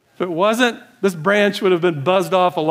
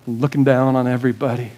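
ordinary talk

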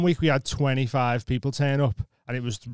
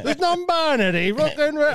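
high mocking voice of a clueless old comic; higher mock-ranting old comedian; older guy ranting; 11 semitones higher than usual. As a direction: send up the bloke's rant, pitched well up. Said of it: "he was going".

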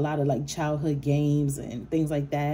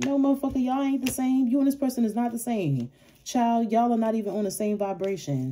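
heated clap-back; 6 semitones higher than usual. Real, not acted. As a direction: shut the doubter down with a raised voice. Clapping back at the doubter.

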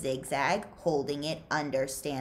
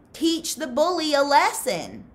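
loud and higher, goading; egging on; 10 semitones higher, 7 dB louder than usual. Acted. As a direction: push him into the fight. Pendanski egging Stanley on.

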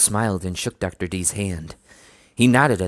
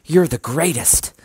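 delighted; 8 semitones higher, 5 dB louder, slower than usual. Acted. Sheena hugs uncle.

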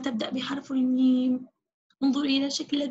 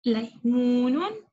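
slow modelled word; 2 semitones lower, slower than usual. Shown as a demonstration. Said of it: "لام".

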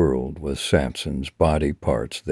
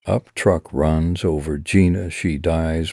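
usual speaking voice

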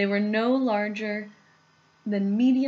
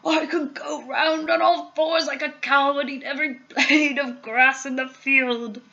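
put-on higher, gleeful old man's voice; delighted grandpa; 5 semitones higher, 4 dB louder than usual. A narrator's reading. Grandpa raving about edible grass, grinning with delight. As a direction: grin all through, relishing it. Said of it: "grinning with delight".

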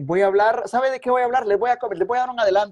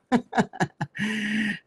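real amused chuckle; short run of easy laughter; 2 semitones higher, 4 dB quieter than usual. Real, not acted. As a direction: laugh lightly at your own forgetfulness. Laughs at forgetting he has Facebook.

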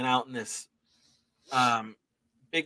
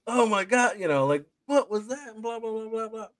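astonished chatter; 10 semitones higher than usual. Retold or imitated. Whole neighborhood just like, retold astonishment. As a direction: imitate a stunned crowd babbling.

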